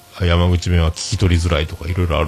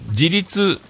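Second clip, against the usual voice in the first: ordinary talk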